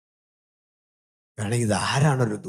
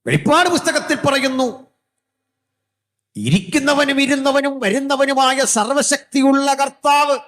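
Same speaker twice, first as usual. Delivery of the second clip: high, loud, sustained pulpit cry; soaring revival preaching; preaching at full force; 6 dB louder than usual. Acted. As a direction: proclaim it like scripture to a crowd.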